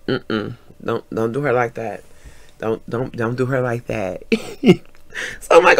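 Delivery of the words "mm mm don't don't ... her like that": low, soft, repeated warning. Retold or imitated. Goddaughter tapping him to protect her, low warning. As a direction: imitate a quiet, firm telling-off. Goddaughter quietly warns the man.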